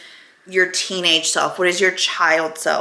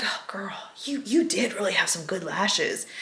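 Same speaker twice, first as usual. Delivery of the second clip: soft unvoiced whisper; breathy hush to her teenage self; 10 dB quieter than usual. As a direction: confide in a hush. Whispering to teen self in dream.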